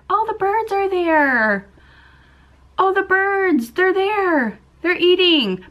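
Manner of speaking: high, drawn-out squeal of delight at the birds; excited outburst. Real, not acted. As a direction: burst out high, thrilled by what you see. Spots birds mid-story, excited outburst.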